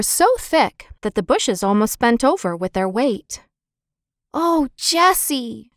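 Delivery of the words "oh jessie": put-on high, slow drawn-out child's call; excited call. A narrator's reading. Benny calling Jessie over the blueberries, high and drawn out. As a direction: call out thrilled at the berry find. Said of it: "screamed Benny".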